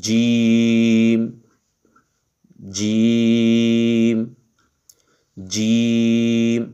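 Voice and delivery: জিম said firmly, very slowly and clearly; slow teacher demo of জিম, hard j; firm jim. Shown as a demonstration. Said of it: "জিমটা একটু শক্ত করে উচ্চারিত হবে".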